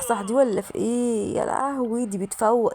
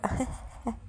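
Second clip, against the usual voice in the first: soft, breathy chuckle; gloating chuckle; 3 semitones higher, 7 dB quieter than usual. Real, not acted. Gamer laughing at the pranked villain, gloating chuckle. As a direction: chuckle quietly at the victim.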